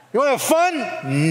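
put-on high coaxing child voice; kid voice. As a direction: coax like a kid pleading. Kids inviting other kids play.

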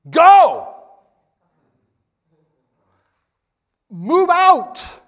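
loud, high shouted order; emphatic command. Shown as a demonstration. Preacher showing the congregation the aggressive form of go, emphatic shout. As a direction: shout it like ordering troops to move. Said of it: "the more emphatic, aggressive".

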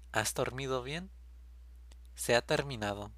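normal talking voice

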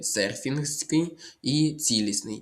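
normal talking voice

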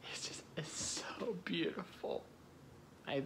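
breathy unvoiced hush; soft adoring whisper. Real, not acted. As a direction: whisper it like a secret crush. Gushing softly about her voice.

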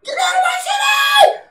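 very high, loud shrieking yell; piercing mock shriek at passersby; imitating screaming woman. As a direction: screech it like the hysterical lady. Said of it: "she's still screaming".

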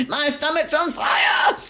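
high, loud alarmed cry; panicked shout. A narrator's reading. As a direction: wail it in panic. Grandma panicking.

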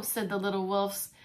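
ordinary delivery

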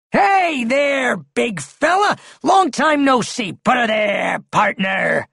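high, hearty greeting called out; high-pitched, chummy call; hailing an old pal. A narrator's reading. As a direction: holler a big hello to an old buddy.

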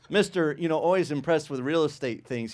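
normal talking voice

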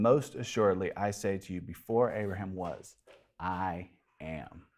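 Jesus answering softly, with weight; solemn reply. Acted. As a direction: let it sink in gravely.

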